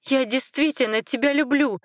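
high-pitched, heated cry of protest; shouting back. A narrator's reading. Wife protests to her furious husband, crying out high. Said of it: "закричала она в ответ".

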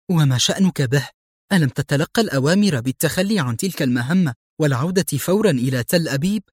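usual speaking voice

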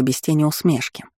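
ordinary delivery, nothing marked

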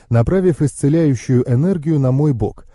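ordinary delivery

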